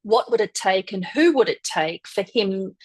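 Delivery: usual speaking voice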